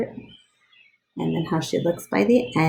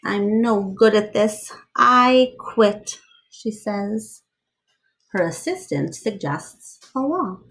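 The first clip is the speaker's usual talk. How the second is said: lively read-aloud storyteller voice; story narration; 4 dB louder, slower than usual. Acted. Reading a picture book aloud to children, lively storyteller voice.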